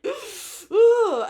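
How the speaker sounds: loud, high retching ugh of disgust; sudden gut-level loud ugh. Retold or imitated. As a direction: recoil with a big loud ugh. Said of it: "and went".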